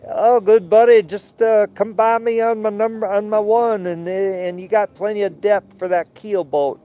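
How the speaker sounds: put-on a cheerful Southern bargeman giving directions, Southern accent; Southern good-ol'-boy accent. Bargeman guiding a keelboat past on the radio, Southern accent. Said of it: "hillbilly guys down south".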